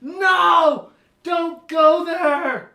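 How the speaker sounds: high, loud frantic shout; parent hollering. Retold or imitated. Parents hollering down the mountain at their kid, frantic high shout. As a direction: yell a warning down the mountain, slow on the last words. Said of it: "hollering down at you".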